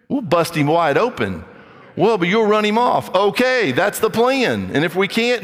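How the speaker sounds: rally the room, half joking; a pastor boasting how he tests suitors; heated preaching. Real, not acted.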